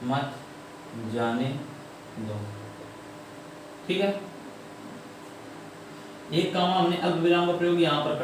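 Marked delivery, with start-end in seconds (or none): 0.0-2.4 long pauses between the words; word-by-word dictation. Shown as a demonstration. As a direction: dictate it slowly, one word at a time. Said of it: "यहाँ पर मैंने लिख दिया". (शब्द-शब्द रुककर लिखवाते हुए)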